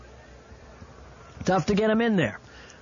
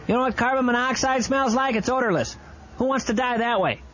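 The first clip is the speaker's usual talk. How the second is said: ordinary delivery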